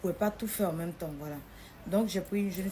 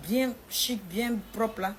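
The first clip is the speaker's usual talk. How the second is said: ordinary talk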